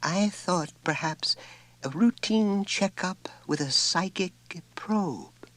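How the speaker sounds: high, slow and careful, picking each word; delicate. A narrator's reading. Teacher gently suggests a psychic probe, high and slow. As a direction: tiptoe around the suggestion. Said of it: "with every attempt at delicacy".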